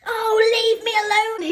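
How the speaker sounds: high loud plea; crying out. A narrator's reading. Noddy cries out as he leaps out of bed. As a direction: cry out in frustration. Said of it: "he cried".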